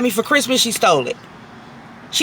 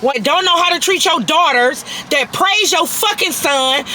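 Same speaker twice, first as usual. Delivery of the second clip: ordinary talk, nothing marked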